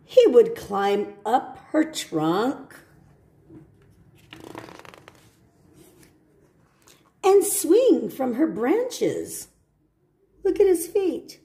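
gentle storybook read; reading to children. Acted. Teacher reading a picture book to children.

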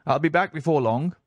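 a hint of an impatient gentleman, high; lightly voiced impatient visitor; restless gentleman. A narrator's reading. Boy quoting impatient gentleman.

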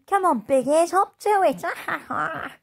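put-on high, loud taunting girl voice; jeering bully. Bully girl taunting passing animals, loud and high. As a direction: jeer at the little animals.

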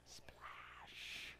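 breathy whispered sound effect; whispered splash. Acted. Preacher voices the axe head hitting water, whispered. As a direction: hush it like a faraway splash.

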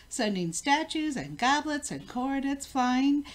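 ordinary delivery, nothing marked

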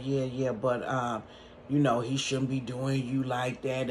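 lightly voiced low-key man on the phone; roommate's voice. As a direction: drop low and lazy like the guy. Voicing the roommate again.

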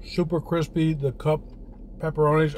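chewing while talking; speaking between hot bites; mouth full of pizza. Real, not acted. Seen 0: Bites pizza at 0.8 seconds, then chews with a full mouth throughout.

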